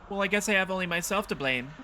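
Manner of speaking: put-on high-pitched old lady, resigned; resigned old woman. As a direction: accept the blame in a high elderly voice.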